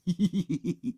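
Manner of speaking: high, gleeful "hee hee"; sniggering laugh. A narrator's reading. Henry privately enjoys fooling Peter, sniggering. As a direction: snicker at your own trick. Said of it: "thought Horrid Henry".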